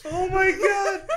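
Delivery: high, loud laughing exclamation; helpless laughter. Real, not acted. Host reacting to friend's drunk video, cracking up. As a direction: cry out while cracking up.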